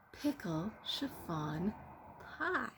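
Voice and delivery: ordinary delivery, nothing marked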